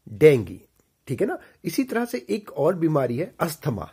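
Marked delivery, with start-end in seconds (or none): 0.1-0.6 low, firm डेंगी ending "gee"; correct pronunciation. Shown as a demonstration. Teacher answering "क्या बोलेंगे?", correct pronunciation. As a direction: drill डेंगी as "den-gee". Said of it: "क्या बोलेंगे?".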